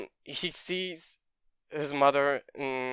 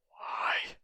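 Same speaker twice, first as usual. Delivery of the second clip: breathless, unvoiced why; stunned whisper; 5 dB quieter than usual. Izuku asking Bakugo in a shocked whisper. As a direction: barely get the word out.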